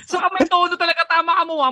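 usual speaking voice

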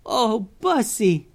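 aunt embracing her lost niece, tenderly; tender relief. Acted. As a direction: melt with relief. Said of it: "said her aunt".

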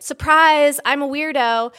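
loud, high "Surprise, I'm a weirdo"; mock grand reveal. To the world, revealing her weirdness with a loud flourish. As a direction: announce it like a big unveiling.

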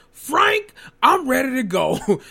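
high, loud mock exit line; mock storming off. Retelling Dolores leaving, mock calling to Frank, high and loud. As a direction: call out to Frank as you storm out. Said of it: "and then she says".

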